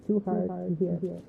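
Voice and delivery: muffled, distant and quiet; through a wall. Acted. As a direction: speak from behind a thick wall. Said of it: "slightly back to normal".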